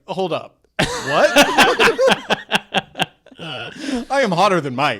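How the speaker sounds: loud, laughing, outraged; slow, indignant and laughing; offended disbelief. Retold or imitated. Melissa McCarthy offended at being paired with Mike.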